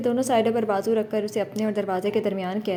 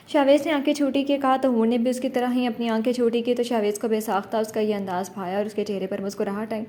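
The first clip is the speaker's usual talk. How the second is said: usual speaking voice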